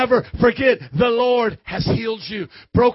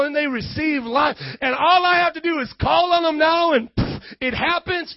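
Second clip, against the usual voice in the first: incredulous doubter; 4 semitones higher than usual. Acted. Preacher voices skeptic hearing of Jesus' miracles, incredulous. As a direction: stretch each miracle out in astonished questioning.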